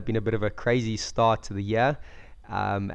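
usual speaking voice

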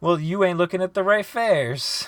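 high, indignant retort; heated rebuttal. Real, not acted. Co-host insisting on the right fairs, indignant. Friends' heated food argument.

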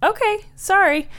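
squeaky, surprised little apology; high apologetic voice. Acted. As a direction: pipe up sheepishly. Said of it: "Ethel is like".

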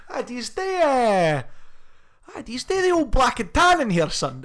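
high, loud hammed-up Scottish accent; mock Scottish accent. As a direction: play a Scot ordering in a New York bar. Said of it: "It's like".

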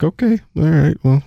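high, taken-aback okay; mock surprised reaction; stunned okay. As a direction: act caught off guard. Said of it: "It's like".

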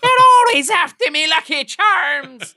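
very high, loud Irish leprechaun voice; Lucky Charms leprechaun. Retold or imitated. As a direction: squeal it like the cereal ad leprechaun. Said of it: "the leprechauns from Lucky Charms".

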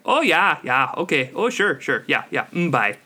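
exaggerated singsong Midwestern accent; Midwestern dialect. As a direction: imitate a Midwesterner signing off a call. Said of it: "I hate the Midwestern dialect".

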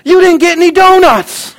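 put-on very high, loud, slow little-girl voice; little daughter. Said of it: "and then she says".

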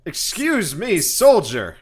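loud, high, barking officer; angry commander. Acted. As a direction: bark it like an irate field marshal. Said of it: "he's like".

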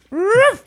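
high yappy bark; dog bark. Pretend. Introducing the dog on air.

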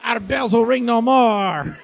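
high, slow, ham French mourning; mock French lament.

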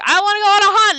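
loud high yell. Retold or imitated. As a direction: shout it at your mother. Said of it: "screaming at each other".

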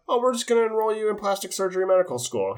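sing-song like a blind proud parent; high, upbeat mock parent; mock cheery parent. Voicing parents enrolling the kid.

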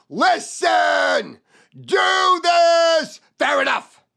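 loud, high listen do this fair enough; shouted key words. Shown as a demonstration. Trainer shouting the three key words. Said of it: "three key words".

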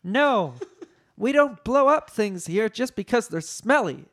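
high, firm scolding; indignant high character voice; Leia. A narrator's reading.

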